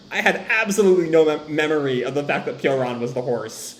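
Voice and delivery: ordinary talk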